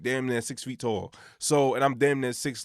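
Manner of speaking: ordinary talk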